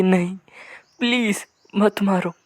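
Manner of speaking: retold desperate plea; pleading. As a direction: plead for mercy, lightly acted. Victims beg as world ends.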